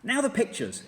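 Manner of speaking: high, loud excited command; shouted. A narrator's reading. Mugglewump shouting orders to the birds. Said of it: "cried Mugglewump".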